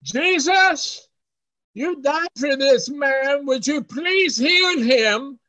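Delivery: high, calling prayer; loud-voiced prayer. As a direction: plead aloud so the man inside can hear. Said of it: "with a loud voice they prayed".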